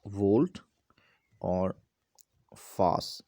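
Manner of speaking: वोल्ट और फास split into separate parts; syllable by syllable. Shown as a demonstration. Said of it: "ऐसे होता है ना".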